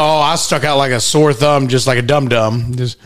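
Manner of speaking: ordinary delivery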